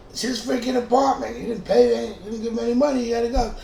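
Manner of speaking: high, loud cop telling him off; a cop, pitched high and loud, sending the guy away; stern cop. Retold or imitated. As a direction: order him out of the apartment. Cop orders roommate out.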